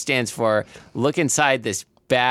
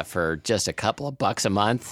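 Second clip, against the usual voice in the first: voice cracking; 4 semitones lower, 5 dB quieter than usual. Real, not acted. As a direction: voice cracks mid-phrase. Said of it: "My, my voice just cracked".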